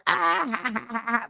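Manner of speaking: small stifled cry, as if shut away; muffled distant yell. Retold or imitated. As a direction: imitate someone yelling from inside a trunk. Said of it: "you hear him muffled".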